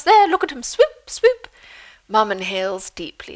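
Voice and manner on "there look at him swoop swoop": very high, loud delight; excited mother. A narrator's reading. Mum watching the flycatcher fly off, delighted. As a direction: sing out as the bird swoops. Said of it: "Off he goes".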